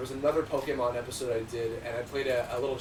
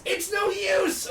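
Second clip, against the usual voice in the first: slow, high hammed-up yell; Silver the Hedgehog shout; much slower than usual. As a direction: yell it like a game hero. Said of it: "he goes".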